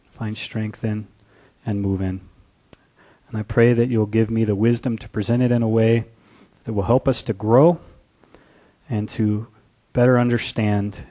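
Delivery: low, soft opening prayer. Acted. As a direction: pray quietly and humbly. Opening prayer before sermon.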